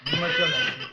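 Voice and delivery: a very high shout, half laughing and half sobbing; hysterical cry. Acted. Raving patient shrieks at the doctor in a laughing, crying frenzy. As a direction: rave it out in a cracked, manic wail.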